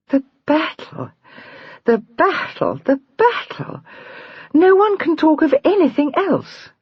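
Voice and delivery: high, strained repeated cry; overwrought. Acted. Julia to Jenny, hand to brow, rising overwrought cry. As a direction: voice rising toward tears.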